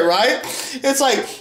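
ordinary delivery

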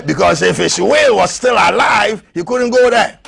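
high, loud preacher's cry; climbing fervent sermon shout; preaching at full force. Acted. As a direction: drive it home like a revival preacher. Preaching climax on the cross.

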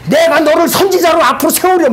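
"너를" punched high in a booming prophetic call; ecstatic prophetic proclamation. Retold or imitated. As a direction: shout it out as if seized by the spirit. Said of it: "막 이-, 이래버리는 거예요".

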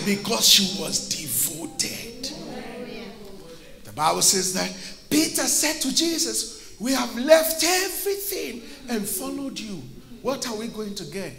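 low, soft storytelling from the pulpit; hushed preaching. Real, not acted. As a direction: drop right down in volume and pitch to draw the congregation in.

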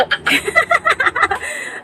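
high breathless unvoiced laugh; high airy giggle. Real, not acted. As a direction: laugh in disbelief, all breath. Laughing at halik tuko comment.

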